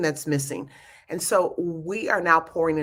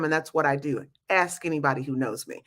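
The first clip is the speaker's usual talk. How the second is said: ordinary talk, nothing marked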